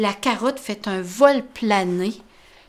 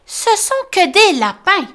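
high, loud cheerful mole voice; Tom smiling; 9 semitones higher, 5 dB louder than usual. Acted. Tom hugged by the rabbit, high smiling voice. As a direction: say it with a big grin.

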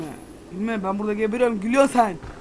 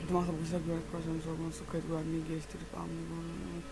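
hushed reading of notes; quiet reading aloud; 8 dB quieter than usual. Acted. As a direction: read the in-game notes softly to yourself. Reads in-game documents aloud.

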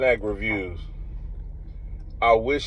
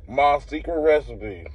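chewing while talking; talking between bites; mouth full of cookie. Real, not acted. Seen 1: Bit cookie at -2; lips pursed, jaw working through words.

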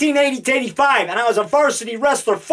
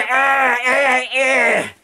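monster growls; 2 semitones higher, much slower than usual. Acted. Devil ant toy attacking.

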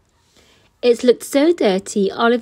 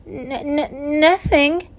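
terrified stammer; 6 semitones higher, slower than usual. A narrator's reading. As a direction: stammer out a frightened denial. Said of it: "stammered Oliver, terrified".

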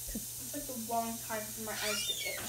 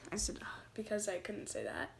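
quick whispered aside; whispering; 2 semitones lower, 8 dB quieter than usual. Real, not acted. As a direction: whisper it under your breath. Embarrassed aside after fumbling a word.

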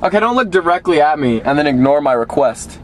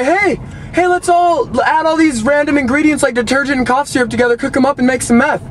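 much higher, silly mock voice; squeaky hammed-up impression of a bad parent; mock quote; 8 semitones higher than usual. Owner mocking what he never said to the dog, squeaky mock voice. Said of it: "I didn't say".

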